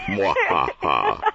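slow, low evil laugh; sneering panto villain. Acted. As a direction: cackle like a pantomime baddie. Caught out, gloating like a villain.

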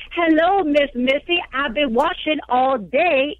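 high, slow mock Southern audition; hammy Southern accent. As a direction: overact a child's bad Southern accent. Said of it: "worst audition for The Help you've ever seen".

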